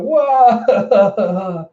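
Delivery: high cartoon bawling cry; hammed-up childlike "wah"; mock wail. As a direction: burst out in exaggerated crying. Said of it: "As he's crying".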